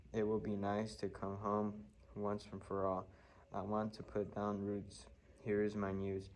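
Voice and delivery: usual speaking voice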